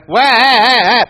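high, loud imitated baby wail; baby crying. Preacher mimics a baby crying in the night, loud wail. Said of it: "Baby's crying again".

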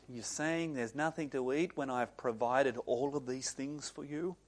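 quiet, restrained mock anger; rising anger. Mum confronts ungrateful teenagers after providing food, restrained anger. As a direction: keep the mum's anger low and held in. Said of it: "the anger rise".

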